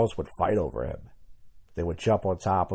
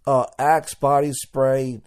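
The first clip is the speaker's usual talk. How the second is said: slow, louder proud answer; 5 semitones higher, 4 dB louder, much slower than usual. Retold or imitated. Guy telling a girl his scent, slow proud answer. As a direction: savor the brand name slowly. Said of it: "I'm like".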